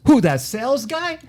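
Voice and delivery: high-pitched, incredulous comic heckler; Artie. Retold or imitated. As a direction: mimic a sidekick's scoffing question, pitched high. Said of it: "That's a good impersonation of Artie".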